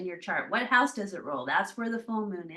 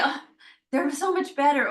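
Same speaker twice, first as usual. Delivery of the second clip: higher, brightly relieved acted answer; upbeat mock reply; 5 semitones higher, 4 dB louder than usual. Patients answer Chiron about their wounds, playfully upbeat. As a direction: lift the pitch like a pleased patient.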